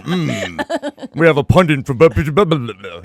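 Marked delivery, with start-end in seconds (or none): 1.1-3.0 pompous cable news panel voice, lightly done; mock TV pundit. Joking about acting smart on camera, mock pundit. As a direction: play a self-important pundit introducing a guest. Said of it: "look like a fucking douchebag on C N N roundtable discussion".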